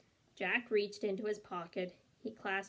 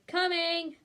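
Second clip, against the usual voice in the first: high, loud shout out the window; 8 semitones higher, 6 dB louder than usual. A narrator's reading. Annie shouting from the treehouse window to Mom. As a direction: yell it back to Mom.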